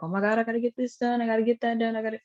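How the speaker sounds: fast, rushed panic; a hurried, half-joking fluster; mock frantic. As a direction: rattle it off like a stressed-out rusher.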